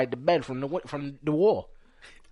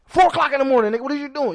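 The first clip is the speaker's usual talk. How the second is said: higher, louder scolding rant; retold angry scolding; 8 semitones higher, 5 dB louder than usual. Brother scolding his brother for the chaos, loud and higher. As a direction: chew out your brother.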